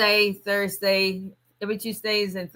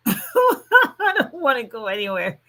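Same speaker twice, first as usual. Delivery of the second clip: higher, slow, laughing whine of a lazy day; mock whiny refusal; 6 semitones higher than usual. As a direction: drag it out like a sulky kid who won't leave home. Retelling lazy Mother's Day answer.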